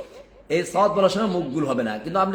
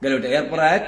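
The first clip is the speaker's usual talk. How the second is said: usual speaking voice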